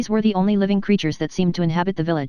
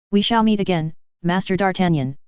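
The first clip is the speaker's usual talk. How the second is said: usual speaking voice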